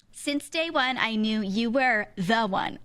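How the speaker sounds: mock speech cadence, slowing to the end; wedding-speech reading tone. Mocking girl's wedding speech to crowd, reading cadence. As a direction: land the last phrase slowly, like a read-out punchline. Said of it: "I hate how good you are at that".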